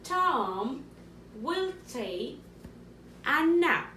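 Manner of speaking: teacher repeating so pupils catch nap; dictation repeat with stress on nap. Shown as a demonstration. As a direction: punch the last words a nap. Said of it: "One more time".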